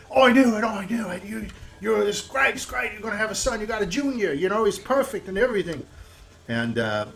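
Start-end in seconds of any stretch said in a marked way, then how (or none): 0.1-5.8 high, gushing congratulations; delighted friend. Acted. Brian congratulating Eric on his son, high and gushing. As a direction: bubble over with joy for him. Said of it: "Brian's like".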